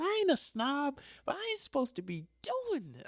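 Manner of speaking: high-pitched, fed-up; high exasperated. Retold or imitated. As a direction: squeal your frustration. Venting to coworker about LA.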